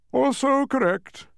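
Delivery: high, drawn-out offhand reply; yawning nonchalance. A narrator's reading. Mathematician conceding nonchalantly to Milo, mock yawn. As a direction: stretch it out slowly, as if yawning. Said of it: "yawned the mathematician".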